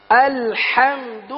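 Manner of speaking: الحمد said slowly with a clear u on the د; corrected vowel. Shown as a demonstration. Teacher correcting the student's vowel, slow and loud. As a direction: stretch الحمد, round the د into du. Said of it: "دال پیش".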